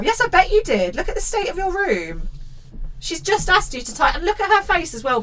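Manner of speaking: high-pitched exasperated telling-off; indignant scolding. Acted. Host telling off the child over the room, indignant.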